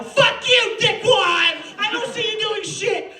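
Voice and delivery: mock yell. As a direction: let rip at the absent teacher. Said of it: "I can yell at you now".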